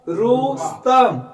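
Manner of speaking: Rustam said slowly, each sound separate; slow drawn demo. Shown as a demonstration. Teacher slowly reading Rustam so students hear the short u. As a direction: stretch Rustam out syllable by syllable. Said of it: "देखो फर्क है।".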